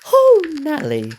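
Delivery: big squealing falsetto "Oh, Natalie"; hammed-up high wail of the name; high dramatic cry.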